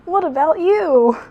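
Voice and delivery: put-on high, chirpy young fox voice; Tails voice. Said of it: "doing that voice".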